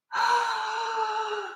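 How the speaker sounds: long, high gasp of real delight; amazed gasp. Real, not acted. Collector seeing new Kokeshi dolls, amazed gasp.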